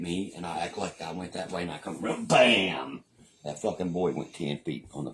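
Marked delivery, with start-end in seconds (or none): none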